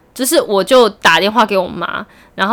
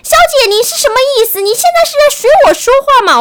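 put-on much higher, angry Mainland Chinese accent; angry scammer; 14 semitones higher, 4 dB louder than usual. Angry scam caller yelling at the mocking buyer. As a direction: snap back at her, furious. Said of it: "气到不行".

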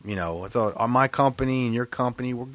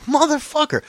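very high-pitched indignant swear; retold frustration squeezed up high; exasperated curse. Driver reacting to the cop's hand on waist, exasperated.

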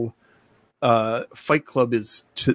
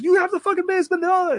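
much higher, fast frantic yell; furious mobster; faster than usual. Retold or imitated. Retelling the owner flipping out at Brad Pitt, frantic yell. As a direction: spit it out fast like a man losing it.

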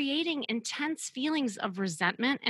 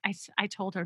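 usual speaking voice

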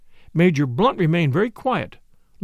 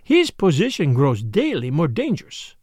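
solemn detective with emphatic, higher-lifted phrases; Poirot, grave and pressing; 4 semitones higher than usual. Acted. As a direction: press her earnestly to give him up.

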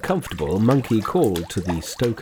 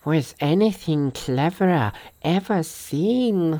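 higher, slow, marvelling delivery; Sandy's character voice; 6 semitones higher, slower than usual. A narrator's reading. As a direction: voice a companion in slow, high tones. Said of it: "said Sandy".

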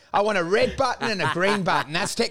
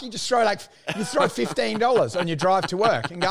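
ordinary talk

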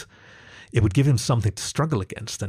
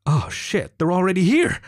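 urgent, higher-pitched hiss; hissed alarm; 9 semitones higher, 3 dB louder than usual. A narrator's reading. Jackal hears the doorbell, hissing in alarm. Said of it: "hissed the jackal".

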